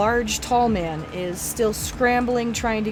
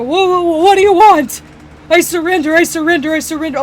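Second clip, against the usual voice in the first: high, loud panicked pleading; panicked surrender; 7 semitones higher, 11 dB louder, faster than usual. Acted. Store owner on the ground surrenders to goblins, loud panic. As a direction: yelp it out in alarm, begging to surrender. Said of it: "he'll just sit there and go".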